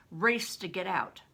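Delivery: ordinary delivery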